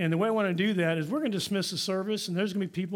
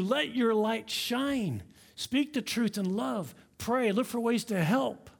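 slow emphatic urging; 3 semitones higher, slower than usual. Real, not acted. Closing exhortation, slowed.

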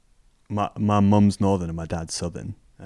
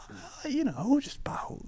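much higher, soft mock mum voice; imitating mum; 12 semitones higher, 8 dB quieter than usual. Son imitating his mum praising his game, high and soft. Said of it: "She's like".

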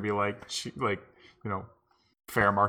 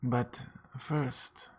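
mock mystical; 2 semitones higher, 5 dB quieter, slower than usual. Fortune teller old lady speaks.

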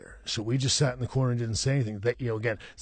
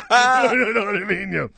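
higher and louder, through real laughter; laughing slowly through the words; 10 semitones higher, 9 dB louder than usual. Real, not acted. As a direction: spill the line out while cracking up. Laughing at double handshake joke.

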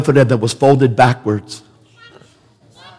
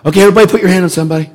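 fast, louder, higher call to the crowd; 7 semitones higher, 4 dB louder, much faster than usual. Retold or imitated. Todd at the crusade telling the crowd to lay hands, calling out. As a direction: rattle off the command to the field.

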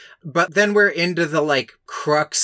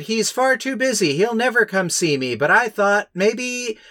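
put-on a dying girl hoping he'll come; lightly voiced frail daughter; wistful sick girl; 8 semitones higher than usual.

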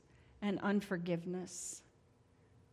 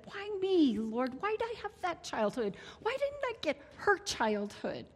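high, anguished protest flung upward; pained cry of why me; railing at God; 6 semitones higher than usual. Retold or imitated. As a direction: shake your fist at heaven and demand why. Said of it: "as I shook my fist at God and said".

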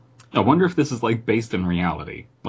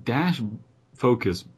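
ordinary delivery, nothing marked

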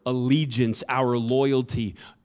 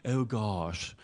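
snooty British; 5 dB quieter than usual. Retold or imitated. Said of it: "arrogant people are British in my mind".

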